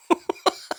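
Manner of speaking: quiet breathy chuckle; a little unvoiced laugh under the breath; soft airy laugh. Real, not acted. Coach laughing at how silly we are, soft airy chuckle. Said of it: "I'm laughing".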